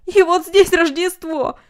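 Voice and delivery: loud, high and slow delight; hearty cry of Christmas joy; joyful exclamation. A narrator's reading. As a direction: announce it with glee.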